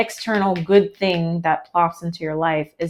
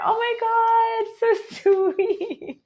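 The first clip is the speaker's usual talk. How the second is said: gushing delight; much slower than usual. Real, not acted. Touched by a viewer's comment.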